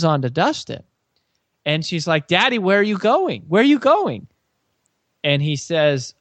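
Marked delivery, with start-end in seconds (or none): 2.3-4.3 put-on high, pleading toddler voice, rising sharply on the repeat; little girl voice. Two-year-old Haven grabbing her dad as he leaves, high pleading child voice. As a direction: imitate a two-year-old begging daddy. Toddler chasing daddy down hallway.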